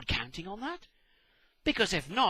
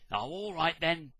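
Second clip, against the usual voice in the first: usual speaking voice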